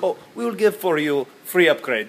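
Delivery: badly faked Russian accent; mock Russian accent. Hotel staff offering guest an upgrade, mock Russian accent. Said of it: "the only accent I can do".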